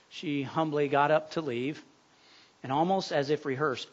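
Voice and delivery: normal talking voice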